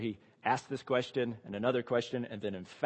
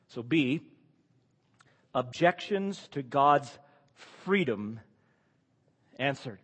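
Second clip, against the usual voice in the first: normal talking voice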